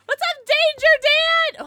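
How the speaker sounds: very high, slow, loud sing-song hello; hyped greeting. Real, not acted. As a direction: announce the name with a squeal. Greeting a chatter.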